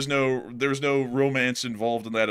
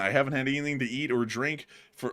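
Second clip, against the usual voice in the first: talking through hiccups; hiccuping mid-sentence; 7 semitones higher, 6 dB louder than usual. Real, not acted. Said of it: "you sound like Otis, like the town drunk".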